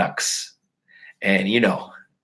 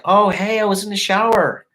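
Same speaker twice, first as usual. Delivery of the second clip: higher, innocent girly voice mocking her excuse; mocking his girlfriend's excuse, higher and sweet; 8 semitones higher than usual. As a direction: play her fake-innocent cover story. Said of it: "she was just like".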